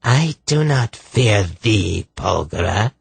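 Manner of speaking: put-on slow, high rasping voice with an odd accent; harsh rasp, peculiar accent. Possessing sorceress defies Polgara, harsh rasp in a peculiar accent. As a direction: rasp it slowly and defiantly in a strange accent. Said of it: "in a harsh, rasping voice, delivered in a peculiar accent".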